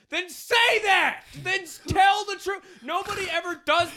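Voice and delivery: high, slow, loud exasperated yell; exasperated shout. Acted. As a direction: shout it out in frustration. Exasperated ranting at partner.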